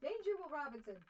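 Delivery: playful robot warning; robot catchphrase. Pretend. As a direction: announce it like the Lost in Space robot. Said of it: "quoting robot catchphrase".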